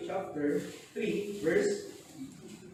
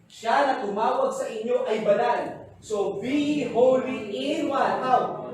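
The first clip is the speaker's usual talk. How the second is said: loud, slow, emphatic preaching; preaching at full force; 6 semitones higher, 11 dB louder, much slower than usual. Acted. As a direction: drive each word home to the congregation. Preacher stresses holiness verse.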